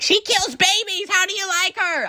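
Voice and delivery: put-on very high, drawn-out kid's accusation; mock accusing shriek. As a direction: screech it like an outraged child. Acts out herself accusing another kid.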